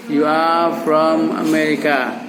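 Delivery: slow, measured sentence for repeating; drawn-out teaching example; slow model phrase. Shown as a demonstration. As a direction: say it slowly for learners to echo.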